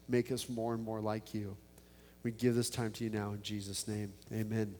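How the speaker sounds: soft, earnest prayer voice; pastoral prayer. Acted. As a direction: pray quietly, gently asking God. Pastor prays aloud to God.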